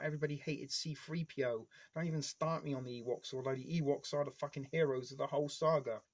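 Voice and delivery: normal talking voice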